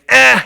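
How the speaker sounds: put-on high, loud toddler "Eh" while reaching; toddler grunt. Preacher imitating a toddler who wants something, high whiny grunt. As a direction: mimic a demanding two-year-old grunting. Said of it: "they say".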